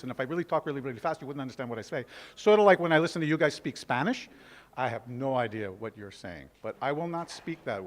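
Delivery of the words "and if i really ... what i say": fast New York talk. Shown as a demonstration. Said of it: "A New York accent is like this".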